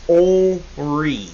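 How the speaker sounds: Henri drawn out slowly, "OHH-ree"; French Henri, light N. Shown as a demonstration. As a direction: stretch Henri out with the N barely there. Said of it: "in French they don't pronounce it very much".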